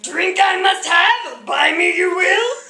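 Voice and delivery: high, slow, strained little Yoda croak; loud, slow mock Yoda impression. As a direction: squeeze the voice up and drag it out like Yoda. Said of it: "he would not stop talking like Yoda".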